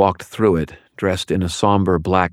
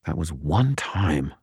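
muttered; 5 dB quieter, slower than usual. A narrator's reading. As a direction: mumble it under your breath. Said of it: "I muttered".

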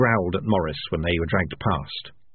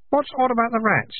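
put-on higher, young boy voice; kid voice. A narrator's reading. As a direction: voice the kid, up high.

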